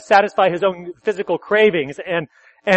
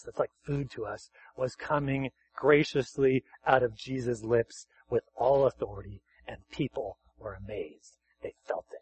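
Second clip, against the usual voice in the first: hushed awe; 6 semitones lower, 8 dB quieter than usual. Real, not acted. Hushed awe over God's word.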